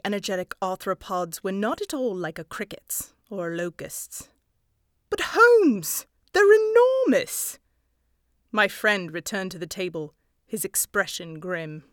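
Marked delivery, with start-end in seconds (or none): 5.1-7.6 much higher and louder, amazed; astonished outburst. A narrator's reading. Watson stunned by giant fleas, high loud astonished cry. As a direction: gasp it out at the size of them.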